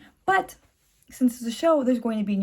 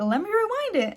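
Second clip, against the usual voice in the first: eager; 10 semitones higher than usual. Retold or imitated. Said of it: "I was like".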